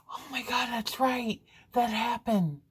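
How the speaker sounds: high, startled dawning memory; sudden realization. Retold or imitated. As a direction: gasp it out as it comes back to you. Sudden realization of last night.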